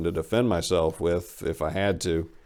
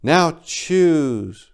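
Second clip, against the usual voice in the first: flatly; 9 semitones higher, 7 dB louder than usual. Acted. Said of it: "she said flatly".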